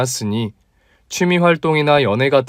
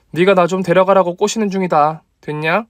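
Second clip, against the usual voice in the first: put-on higher, teasing young woman; female friend voice; 6 semitones higher than usual. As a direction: play the bold female doctor, pitched up. (높은 톤의 당찬 여자 목소리)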